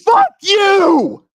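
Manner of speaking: high, loud cursing yell; furious shout. Real, not acted. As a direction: scream it at him with full rage. Heated quarrel on live call.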